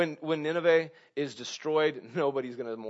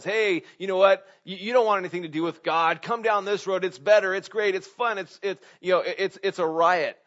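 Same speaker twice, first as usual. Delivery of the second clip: lightly voiced tempter, higher and louder; devil tempting; 5 semitones higher, 6 dB louder than usual. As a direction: play an eager, pushy sales pitch for sin. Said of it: "when the devil says".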